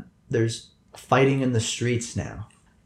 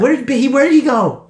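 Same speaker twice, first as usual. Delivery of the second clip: high-pitched character voice; 12 semitones higher, 6 dB louder than usual. Acted. Commissar voice, high.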